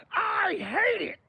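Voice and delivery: high, slow silly voice; lightly mocking squeal; squeaky mock voice. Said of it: "This guy".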